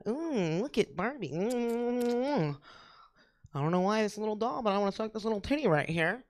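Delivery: soft, hushed little-girl voice playing with a doll; childish musing. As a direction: muse softly like a kid playing with dolls. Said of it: "when you get your Barbies all dressed".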